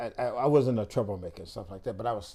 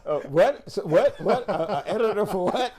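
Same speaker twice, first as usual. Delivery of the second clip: baffled laughing disbelief; 8 semitones higher, 5 dB louder than usual. Retold or imitated. Offered base editor job, baffled.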